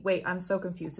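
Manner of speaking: ordinary delivery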